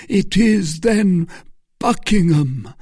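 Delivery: high excitement. Acted. Felton crying the name in excitement. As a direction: burst out with the name. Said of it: "cried Felton in a high state of excitement".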